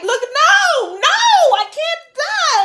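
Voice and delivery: very high, loud protest; desperate shout. Retold or imitated. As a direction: yell to escape death.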